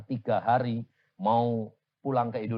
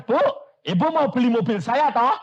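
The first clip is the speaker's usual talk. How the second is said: excited direct ask; 13 semitones higher, 9 dB louder than usual. Acted. Acting direct question to widow.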